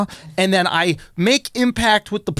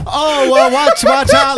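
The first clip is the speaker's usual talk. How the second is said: put-on girlfriend's warning; 7 semitones higher, 4 dB louder, slower than usual. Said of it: "she was like".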